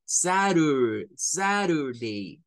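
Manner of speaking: Saturday slow, SA-rur-day, T as flap R. Shown as a demonstration. Teacher imitating other Americans saying Saturday. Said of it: "como rur".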